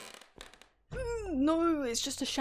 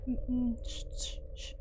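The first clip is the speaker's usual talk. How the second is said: hushing; 2 semitones lower, 9 dB quieter than usual. Real, not acted. Hushing while hiding.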